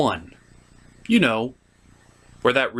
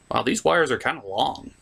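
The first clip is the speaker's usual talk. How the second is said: ordinary talk, nothing marked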